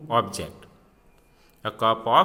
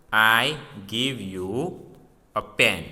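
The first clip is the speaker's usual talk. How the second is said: slow, word-by-word reading of I give you a pen; slow example reading; slower than usual. Shown as a demonstration. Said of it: "See the example".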